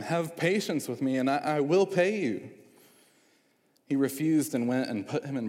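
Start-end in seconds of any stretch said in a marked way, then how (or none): 0.0-2.5 urgent begging, higher and louder; pleading. A narrator's reading. Fellow servant begging the unforgiving servant, pleading. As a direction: plead desperately for mercy. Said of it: "pleaded with him".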